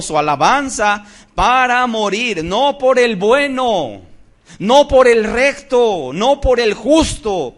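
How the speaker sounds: loud, rising preacher's cry, slowing on each "no por el"; preaching at full force. Acted. Preacher proclaiming Christ's sacrifice, at full force. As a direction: preach it out over the congregation.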